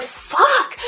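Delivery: high, loud burst of anger; frustrated curse. Retold or imitated. Streamer reliving the webmaster's insult, cursing high and loud. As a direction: spit it out in fury. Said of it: "I was so mad".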